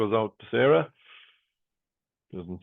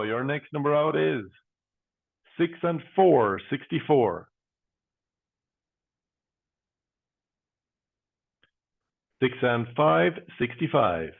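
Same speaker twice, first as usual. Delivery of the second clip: steady, rhythmic number calling; bingo caller. Acted. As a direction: call each number out, then pause.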